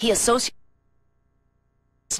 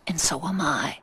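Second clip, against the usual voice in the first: whispered tenderly; 3 semitones lower, 4 dB quieter than usual. Acted. Aunt comforting grieving nephew.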